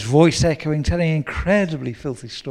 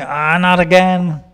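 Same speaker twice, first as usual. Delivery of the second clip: slow, louder, fed-up sigh of complaint; weary groan; 5 semitones higher, 8 dB louder than usual. Retold or imitated. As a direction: grumble about the neighbours again. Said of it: "instead of going".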